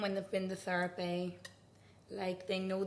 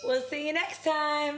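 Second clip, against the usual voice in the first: podcast sign-off; 8 semitones higher, 7 dB louder than usual. Real, not acted. Signing off the podcast.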